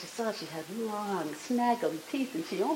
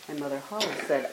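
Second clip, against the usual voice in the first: ordinary delivery, nothing marked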